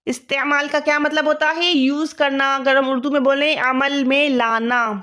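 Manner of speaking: ordinary delivery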